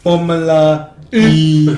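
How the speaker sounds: put-on slow, stretched comic French accent; hammed-up French accent; mock French accent. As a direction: draw it out like a movie Frenchman. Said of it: "that's how I do my French accent".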